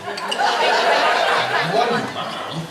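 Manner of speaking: slow, drawn-out mimicry of his son; slow jealous-dad parody; mocking imitation. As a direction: draw it out, sulking in mock hurt. Bitter dad repeats son's words.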